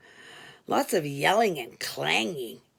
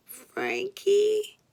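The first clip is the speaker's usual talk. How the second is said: much higher, odd little inmate voice; Frankie's voice; 12 semitones higher than usual. Retold or imitated. Inmate Frankie giving his name to the operator, high-pitched. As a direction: squeak out his own name.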